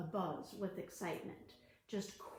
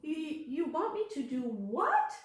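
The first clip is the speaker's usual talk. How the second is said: higher, louder shocked question; 5 semitones higher, 6 dB louder than usual. Acted. Martina reacts to grandmother's advice, shocked and louder. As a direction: gasp out the question in disbelief. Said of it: "said Martina".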